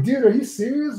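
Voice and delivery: high-pitched incredulous protest; retold disbelief. Answering a friend's teasing, mock disbelief. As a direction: act out being taken aback.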